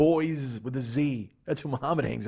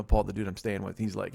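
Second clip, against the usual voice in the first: ordinary delivery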